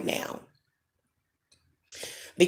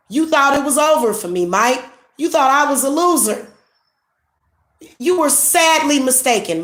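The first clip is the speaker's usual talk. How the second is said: raised, higher and louder, telling him off; scornful rebuke to Mike, higher and louder; angry scolding; 7 semitones higher, 3 dB louder than usual. Real, not acted. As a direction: let him have it, voice pitched up.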